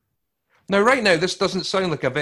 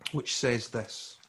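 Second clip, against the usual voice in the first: hushed sermon voice; 6 semitones lower than usual. Acted. Quiet lowered sermon passage.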